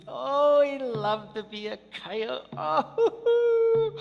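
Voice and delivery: high, loud gushing over coyote; bursting with coyote pride; delighted storyteller. A narrator's reading. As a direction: gush with delight at being coyote. Storyteller voicing coyote's joy.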